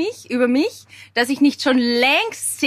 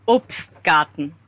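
slow and careful Obstgarten with a lisp; with braces; 7 semitones lower, slower than usual. Shown as a demonstration. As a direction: say Obstgarten slowly around braces. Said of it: "Sag einmal Obstgarten".

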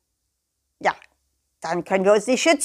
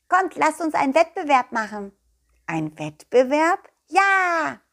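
eager nettle; 6 semitones higher, 3 dB louder than usual. Acted. Nettles proposing contest.

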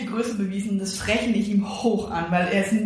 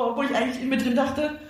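usual speaking voice

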